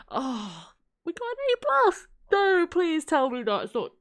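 high, loud excited squeal; can't believe the good news; reacting to an A plus, a high loud excited squeal; amazed squeal. Real, not acted. Amazed at the grade.

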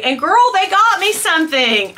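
usual speaking voice

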